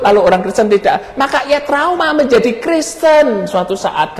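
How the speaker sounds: high, emphatic pulpit voice; impassioned preaching. Acted. Preacher warning the congregation about trauma, impassioned.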